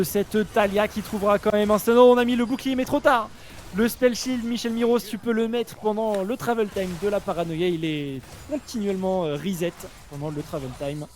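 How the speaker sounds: high-energy live commentary, pitch up; esports caster. Real, not acted. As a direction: hype the teamfight for viewers.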